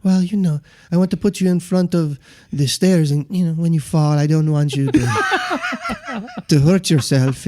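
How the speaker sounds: mock-meek and unhurried; Joe Haas. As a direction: imitate a passive man gently explaining. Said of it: "very quiet and passive in the way he talks".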